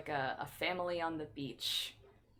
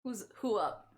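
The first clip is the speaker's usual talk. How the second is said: usual speaking voice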